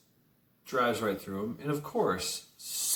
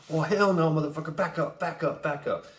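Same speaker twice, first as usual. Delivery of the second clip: higher voice, laughing through a mock warning; laughing mock defiance; 6 semitones higher than usual. Old driver warns crowd off, laughing high mock voice. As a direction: play the old man's bluster while cracking up. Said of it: "He goes".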